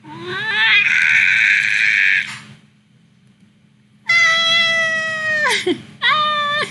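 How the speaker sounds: very high squealing cries; excited screams. Real, not acted. Fan shrieking at the fan art, high squeals. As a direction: shriek with delight.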